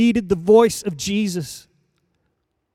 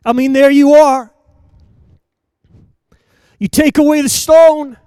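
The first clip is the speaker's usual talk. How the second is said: louder, higher preacher's cry; fiery preaching; 7 semitones higher, 12 dB louder than usual. Acted. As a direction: proclaim it to the congregation. Preacher building to full force.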